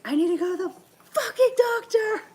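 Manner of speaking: high, strained mock scream; reenacted screaming. Sick daughter finally snaps at mom, screaming. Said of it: "I started screaming at her".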